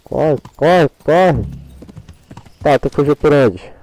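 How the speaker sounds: loud, frantic urging of the horse; panicking gamer. Real, not acted. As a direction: yell it like the chase is on. Escaping on horse, shouting. (gamer em pânico, gritando)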